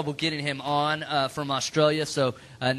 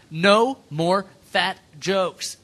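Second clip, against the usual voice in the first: "No more fat jokes" slow and louder, word by word; all-caps emphasis; 3 semitones higher, 5 dB louder, much slower than usual. Retold or imitated. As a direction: read "No more fat jokes" as if in capital letters. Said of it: "all caps, too, so it's important".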